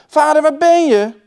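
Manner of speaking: high, loud searching call. A narrator's reading. Children searching the wood for father, loud high call. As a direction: shout it into the trees. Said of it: "riepen ze".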